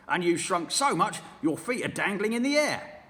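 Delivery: sneering husband, loud; mean old husband taunting his wife; Mr Twit. Acted. Mr Twit's dialogue.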